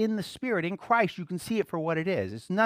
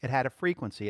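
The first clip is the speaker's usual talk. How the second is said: ordinary talk, nothing marked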